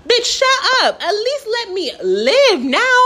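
high-pitched exasperated retort. Retold or imitated. Lost hiker telling sister off, high and exasperated.

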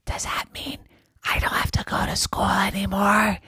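hoarse whisper with no voice; lost voice. Acted. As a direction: try to talk but only air comes out. Jerry voiceless throughout.

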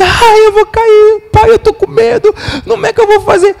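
put-on a small child sure she will fall; terrified child.

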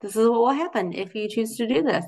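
just lay out the consequence, no heat; matter-of-fact. Retold or imitated. God telling people the consequence, calmly matter-of-fact. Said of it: "matter of factly".

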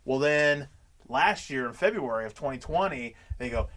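normal talking voice

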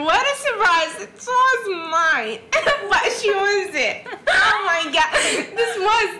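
laughing hard, near tears. Real, not acted. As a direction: laugh through the words, half crying. Laughing at her own story.

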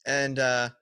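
ordinary delivery

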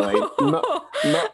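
laughing through the words; breaking into laughter mid-sentence. Real, not acted. Laughs at own bad phrasing.

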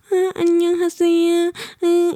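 mock the cute foreigner's voice. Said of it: "با اون صدای نازک". (تقلید صدای نازک و لوس)

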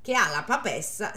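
ordinary delivery

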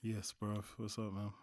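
low, soft, deflated greeting; flat and low. Real, not acted. Greeting the podcast host, low and deflated. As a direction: greet them with no energy at all. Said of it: "You can sound a little bit more lively than that".

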